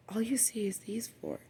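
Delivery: hushed unvoiced whisper. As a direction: whisper it like a secret. Hushed aside in the story.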